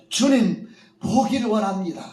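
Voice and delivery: loud, high, urgent cry; eager plea. A narrator's reading. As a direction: blurt it out without hesitation. Blind man answers eagerly. (지체 없이 외치는 간절한 소원)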